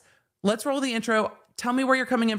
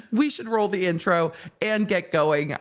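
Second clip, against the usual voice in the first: normal talking voice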